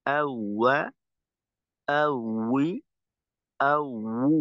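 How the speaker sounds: ওয়া, উই, উ, আউ each drilled slowly with rounded lips; correct waw drill. Shown as a demonstration. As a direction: model each sound for repetition. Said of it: "উচ্চারণ করবো এভাবে".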